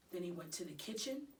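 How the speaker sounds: usual speaking voice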